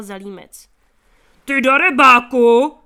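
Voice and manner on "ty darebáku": furious shout at full volume, slow and hard; angry shout. A narrator's reading. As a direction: roar it at the thief. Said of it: "vykřikl zároveň zlý, drsný a neurvalý hlas". (zuřivý výkřik, velmi hlasitě)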